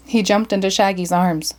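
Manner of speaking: plain reading voice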